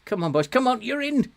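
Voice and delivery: rising, loud cheer for the chance; excited cheering. Real, not acted. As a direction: urge the striker on. Cheering attack on goal.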